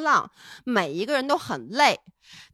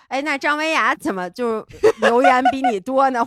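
a hint of a complaining mother; mock nagging mom; 6 dB louder than usual. Imitating mom comparing her to 张维雅, mildly nagging. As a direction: lightly voice mom's jealous nagging.

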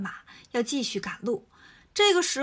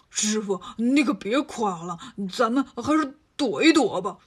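Bajie's wary voice; 3 semitones lower than usual. Acted. Bajie warns his master.

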